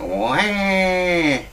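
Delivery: long, high imitation wail; one drawn-out, put-on wah; mock baby cry. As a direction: fake a baby's bawl. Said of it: "And I went".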